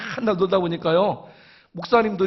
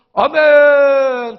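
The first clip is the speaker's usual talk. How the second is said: long, loud held amen; loud drawn-out amen; 6 semitones higher, 12 dB louder, much slower than usual. Retold or imitated. Elder answering the proposal for dawn service, loud drawn-out amen. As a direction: belt out amen and stretch it.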